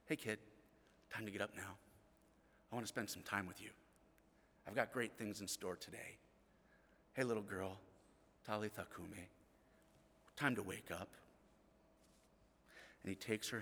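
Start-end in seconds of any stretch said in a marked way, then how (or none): 0.1-11.1 tender parent wake-up. Retold or imitated. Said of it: "I would call my kids".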